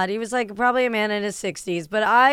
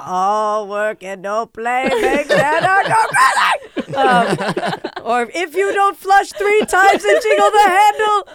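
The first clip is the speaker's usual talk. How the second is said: slow, hammed-up spooky intonation, then higher and louder; mock horror movie line; 8 semitones higher, 7 dB louder, slower than usual. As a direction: ham up a haunted-hotel madman.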